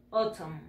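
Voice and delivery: "Autumn" said short and low, OH-tom; pronunciation model. Shown as a demonstration. Teacher confirms how it is pronounced, "Autumn" as quiet OH-tom. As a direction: drop "Autumn" softly, with an o at the start. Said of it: "Así se pronuncia".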